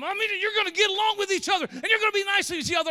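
high, loud, rapid-fire parental scolding; mimicking a parent ordering the kids to behave. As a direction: lay down the law like a fed-up mom. Parent scolding children, acted.

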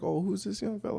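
sweet adult greeting. Retold or imitated. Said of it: "It's like".

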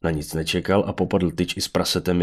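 ordinary delivery

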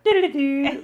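high sing-song doodly-doo; high doodly-doo hum. Shown as a demonstration. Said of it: "I go".

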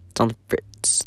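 half to himself, barely voiced; whispered echo. Real, not acted. Echoing his racing mind in a whisper. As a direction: repeat it in a breathy whisper.

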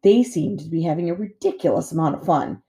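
plain reading voice

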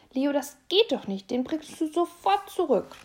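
loud, high, stern scolding; mild mother's reprimand to her son. A narrator's reading. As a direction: order him to return it at once.

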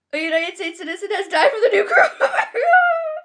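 reading with a nervous laugh. Real, not acted. Reading the headline to viewers, nervous laugh. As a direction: laugh despite the bad news.

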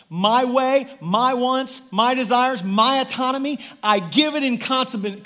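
loud, high, punched list; forceful preaching. Real, not acted. Preacher giving up his own way to God, forceful preaching. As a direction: hammer out each item of surrender.

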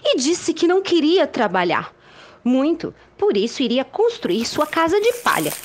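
lively storybook narration; reading to children. A narrator's reading. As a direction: tell it like a bedtime tale.

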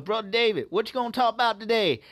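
put-on Southern accent. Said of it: "my Southern accent is terrible".

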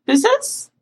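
very high, innocent query; feigned ignorance. Retold or imitated. Operator to regular caller she pretends to forget, mock innocent. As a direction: play clueless, pitch right up. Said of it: "I just pretend like I don't know who he is".

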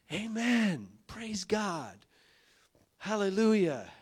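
high, loud amens; excited church shouts. Retold or imitated. Preacher voicing the excited church crowd, high and loud. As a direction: shout it like a fired-up congregation.

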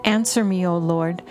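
usual speaking voice

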